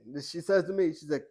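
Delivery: usual speaking voice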